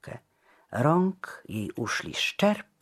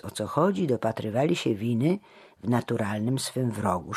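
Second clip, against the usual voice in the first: ordinary delivery, nothing marked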